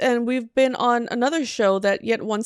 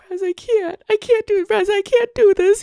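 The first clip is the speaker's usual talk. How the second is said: high anxious pleading; 10 semitones higher, 5 dB louder than usual. Retold or imitated. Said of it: "I'm like".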